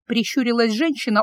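usual speaking voice

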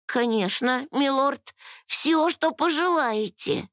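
high, eager-to-serve voice; high-pitched obliging fairy; fairy servant. A narrator's reading. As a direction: answer your lord in a high little voice. Fairy servant answers her lord.

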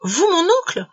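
high-pitched, incredulous questioning; astonished. A narrator's reading. Nephew amazed at his uncle's offer, astonished questioning. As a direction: stammer in sheer astonishment. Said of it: "saisi d'étonnement".

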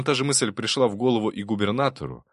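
plain reading voice

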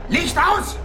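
furious shout, pitch flying up; yelling. Acted. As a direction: bellow it so she obeys now. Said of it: "wieso brüllst du mich so an".

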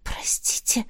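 very soft, almost voiceless apology; embarrassed mumble. A narrator's reading. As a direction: mumble it under your breath, embarrassed. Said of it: "смущённо пробормотала я". (смущённое бормотание)

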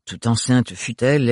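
plain reading voice

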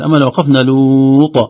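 normal talking voice

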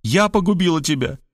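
theatrical self-accusation, pitch up; fervent exclamation. A narrator's reading. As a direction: build up scorn, breaking off unfinished. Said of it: "вдохновенно воскликнула она".

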